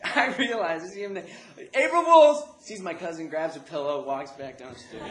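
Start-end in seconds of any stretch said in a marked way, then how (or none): 1.7-2.4 high, loud "gotcha" call; loud, high-pitched prank reveal; prank reveal shout. Retold or imitated. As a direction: yell it out triumphantly. Prank punchline called out.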